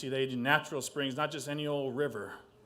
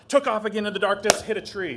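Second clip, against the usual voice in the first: fast excited; 7 semitones higher, 11 dB louder, faster than usual. A narrator's reading. Raven flying off again.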